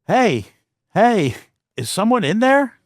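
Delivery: lightly voiced older stranger calling out high. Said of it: "it sounded like an older person".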